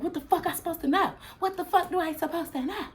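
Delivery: high, fast, outraged comeback; indignant retort. Retold or imitated. She snaps back at the flirting stranger, high outraged retort. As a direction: fire it back at him in disbelief.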